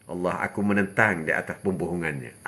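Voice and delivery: low, soft murmur to God; hushed inner prayer. Shown as a demonstration. Preacher shows resisting lies only in the heart, hushed and low. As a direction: pray it quietly as if inside your heart. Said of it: "Dalam hati".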